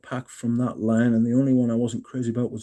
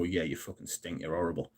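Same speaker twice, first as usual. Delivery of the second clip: muttered insult; 5 semitones lower, 13 dB quieter than usual. Retold or imitated. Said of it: "I was so tempted to go".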